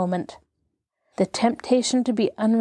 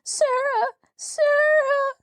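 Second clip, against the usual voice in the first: put-on very high wailing child calling a name; a little girl crying out for her protector; much slower than usual. A narrator's reading. Little Lottie calls for Sarah, high wailing cry.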